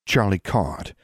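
usual speaking voice

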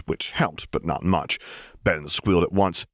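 usual speaking voice

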